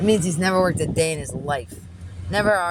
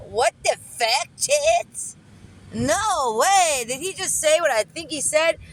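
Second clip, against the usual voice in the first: high-pitched, incredulous outburst; shocked disbelief; 9 semitones higher, slower than usual. Retold or imitated. Driver stunned by what the customer said, shocked disbelief. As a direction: react as if you can't believe your ears. Acts out her shocked reaction.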